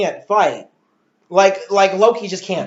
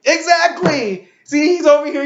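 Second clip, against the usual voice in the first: excited agreement; 9 semitones higher, 3 dB louder than usual. Real, not acted. Father bursts out in agreement.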